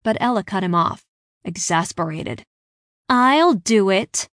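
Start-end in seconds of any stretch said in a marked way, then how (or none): 3.1-4.3 louder, slow, eager volunteering; volunteer with a grin in the voice; grinning. A narrator's reading. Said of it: "she grinned at me".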